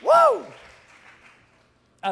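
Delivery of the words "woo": loud high-pitched "woo"; whoop. Real, not acted. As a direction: let out a sudden high whoop. Whoop after the love line.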